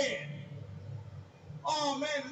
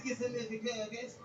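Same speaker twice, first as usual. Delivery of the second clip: put-on kid's voice; 4 dB quieter than usual. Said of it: "He said".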